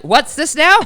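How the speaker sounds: high, loud bewildered question; re-enacted alarm. Farmhand handed a chick to hold, alarmed. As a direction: blurt it in mock alarm.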